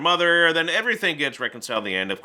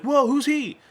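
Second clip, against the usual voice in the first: much higher startled whoa; surprised; 12 semitones higher than usual. Retold or imitated. Kid spotting the unknown uncle, high startled surprise. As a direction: jump back in mock shock. Family reacts to stranger.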